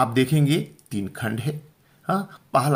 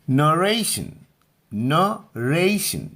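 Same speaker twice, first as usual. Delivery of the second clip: Narration slow and raised, strong रे; deliberate, higher-pitched model of narration; stress on middle syllable; 4 semitones higher, much slower than usual. Shown as a demonstration. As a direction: say narration as nuh-RAY-shun, middle syllable held. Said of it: "बीच वाले पे".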